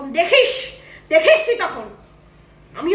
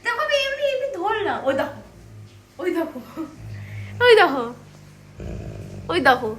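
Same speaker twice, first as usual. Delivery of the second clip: ordinary talk, nothing marked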